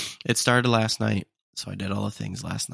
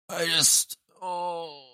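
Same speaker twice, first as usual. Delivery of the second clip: mock celebration; 8 semitones higher, much slower than usual.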